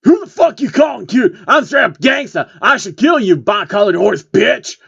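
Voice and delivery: high, loud hammed-up gangsta shout; over-the-top tough-guy yell; angry rapper threat. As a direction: threaten the pony. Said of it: "one of the bipeds to reply".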